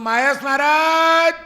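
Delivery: long, high call across the forest; loud shout. Retold or imitated. Monk calling out across the jungle to his companion, long loud shout. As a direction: shout the name to a far-off friend. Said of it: "મેં બૂમ મારી".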